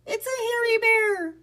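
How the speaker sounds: very high, loud frightened cry; panicked alarm. A narrator's reading. Rat Pack suddenly seeing Boris, panicked alarm. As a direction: cry out in storybook terror. Rats panic at sight of bear.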